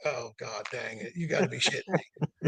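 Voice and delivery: low, muted groan of disbelief; retold dismay. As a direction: drop low and soft, half laughing in dismay. Seeing his friends pulled over, retold.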